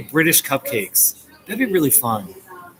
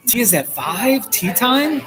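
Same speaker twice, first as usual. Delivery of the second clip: surprised disbelief; 10 semitones higher than usual. Real, not acted. Hearing British tea is at five.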